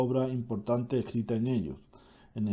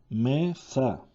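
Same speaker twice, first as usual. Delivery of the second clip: "mesa" lento, con una pausa entre "me" y "sa"; separado en sílabas. Shown as a demonstration. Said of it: "tiene dos sílabas". ("mesa" silabeando despacio)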